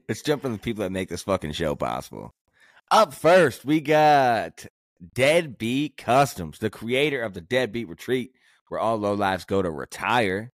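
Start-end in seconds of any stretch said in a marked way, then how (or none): 2.9-10.5 loud, high hype sponsor read; hype announcer. Acted. Sponsor shout-outs.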